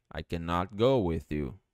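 ordinary talk, nothing marked

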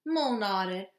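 ordinary delivery, nothing marked

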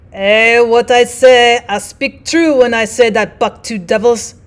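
slow, loud French-Canadian drawl to open; Francois declares it in a thick French-Canadian accent; a rough French-Canadian trapper vouching. Acted. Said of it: "This was Francois's speech".

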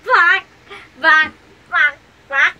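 loud mock chicken clucks. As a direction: cluck like a hen on cue.

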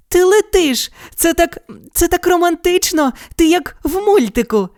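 put-on high, thrilled girl's voice; excited girl, clapping with glee; delighted girl. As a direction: gush with romantic delight. Girl delighted by flying boy.

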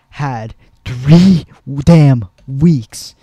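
loud, drawn-out emphatic complaint; exasperated outburst. Real, not acted. Gamer ranting at developers over a buggy update, exasperated outburst. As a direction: hammer each word slowly in frustration.

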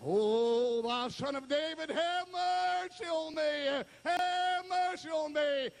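long, high pleading holler; drawn-out beggar's wail. Acted. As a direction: cry for mercy, swelling and climbing. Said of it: "he cried the much the more".

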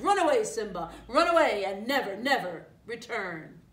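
loud, forceful order to flee; snarling command. A narrator's reading. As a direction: drive the cub away, loudly. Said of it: "Scar snarled".

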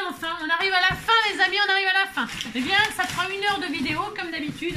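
high, fast and bright sign-off; cheerful host closing the video; excited wrap-up. Real, not acted. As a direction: announce the end with a burst of glee.

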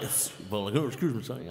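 a light, laughing imitation of Boz; half-hearted Boz impression through laughter. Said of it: "do your Boz impression".

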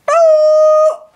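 very high loud laughter; shrieking laugh. Real, not acted. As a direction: crack up in a shriek. Bursts out laughing.

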